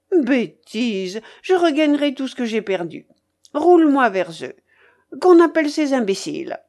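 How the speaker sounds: high, forceful, dismissive orders; imperious old gambler. Acted. Babouchka orders her porters called, impatient. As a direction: brush off the warning and command.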